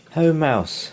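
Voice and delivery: hare greeting. Acted. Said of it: "says Hare".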